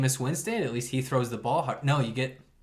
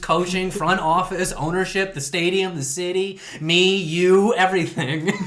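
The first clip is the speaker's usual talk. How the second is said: ranting list; 5 semitones higher, 6 dB louder than usual. Acted.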